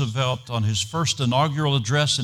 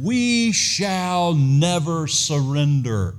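defiant Churchill line; 4 semitones higher, 3 dB louder, much slower than usual. Retold or imitated. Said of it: "drew himself to his greatest height and said".

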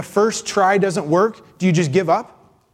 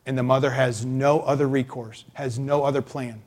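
usual speaking voice

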